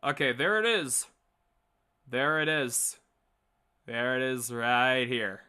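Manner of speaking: ordinary talk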